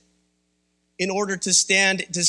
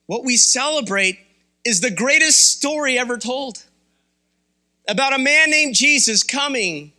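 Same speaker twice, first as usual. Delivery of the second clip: higher, louder preacher's proclamation; preaching at full force; 4 semitones higher, 3 dB louder than usual. Acted. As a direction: lift the room with the story. Preaching the gospel story.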